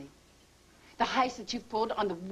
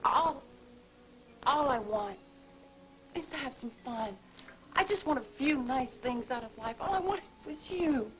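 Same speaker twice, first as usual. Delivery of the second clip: crying through the words; tearful; 4 semitones higher than usual. Acted. Gloria tearfully admitting she wanted Craig. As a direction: fight back the tears.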